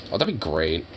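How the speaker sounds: ordinary talk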